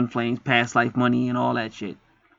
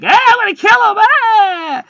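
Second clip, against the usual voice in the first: much higher, loud hammed-up screech; mock rage; 8 dB louder, slower than usual. Vlogger mocking a jealous hater, loud screeching threat. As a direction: shriek it like a cartoon villain. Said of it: "she's probably like".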